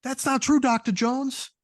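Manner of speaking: very high, squeaky protest; squeaky henchman insisting the other Doctor Jones is fake; high squeaky impostor. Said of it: "the call out of another individual".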